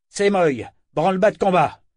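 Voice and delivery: high, loud order; calling out orders. A narrator's reading. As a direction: bark the call to arms. Captain calling crew to battle.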